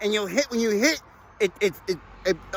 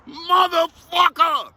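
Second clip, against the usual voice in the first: cursing shout; 6 semitones higher, slower than usual. Retold or imitated. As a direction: bellow the curse like you just smacked the windshield. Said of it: "You cursing like a motherfucker".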